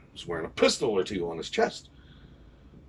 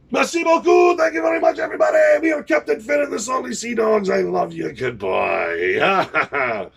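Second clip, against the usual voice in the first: much higher, shouted farewell; stage farewell; 12 semitones higher, 5 dB louder than usual. Real, not acted. Pirate band leader bidding the hall farewell, shouting. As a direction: bellow thanks to the crowd.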